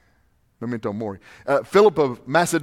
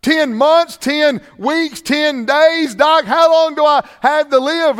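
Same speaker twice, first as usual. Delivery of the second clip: panicked patient; 10 semitones higher, 7 dB louder, much slower than usual. Retold or imitated. Patient panics at doctor's answer.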